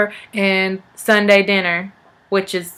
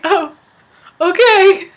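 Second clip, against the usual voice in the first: high, humoring okay; bemused acceptance; 9 semitones higher, 5 dB louder, slower than usual. Acted. Teller answering the kid's werewolf claim, high bemused okay.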